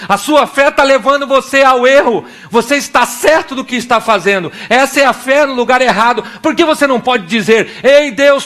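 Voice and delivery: usual speaking voice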